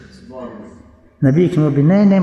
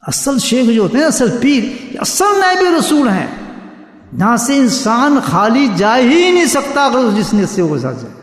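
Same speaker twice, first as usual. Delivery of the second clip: raised, impassioned sermon voice; preaching; 7 semitones higher than usual. Acted. Preacher extols true saints, impassioned and raised. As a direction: proclaim it to the congregation.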